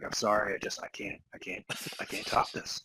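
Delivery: whispered, speechless with awe; mock awed whisper. Gabe admits he can't top this, whispered in awe. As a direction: whisper as if overwhelmed. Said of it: "Gabe saw this and he's like".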